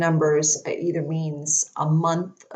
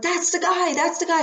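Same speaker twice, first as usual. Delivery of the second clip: much higher, excited repeat; thrilled recognition; 11 semitones higher than usual. Retold or imitated. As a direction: burst with the discovery. Recognizing the spirit in photo.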